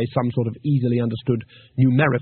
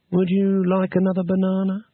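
higher, coaxing as if to a pet; coaxing offer; 6 semitones higher than usual. Acted. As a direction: tempt the ape sweetly. Offering banana to the ape.

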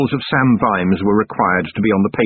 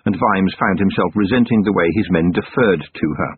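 usual speaking voice

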